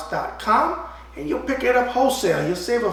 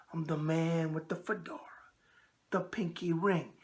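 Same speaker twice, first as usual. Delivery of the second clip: whispered; 2 semitones lower, 9 dB quieter than usual. Acted. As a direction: lean in and whisper it. Dramatic whispered self-intro.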